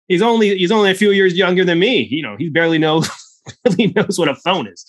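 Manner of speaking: high, indignant old Texan imitation; Texan accent. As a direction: scoff like a cranky old Texan. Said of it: "accent every single time".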